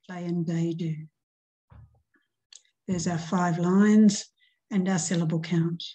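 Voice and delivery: normal talking voice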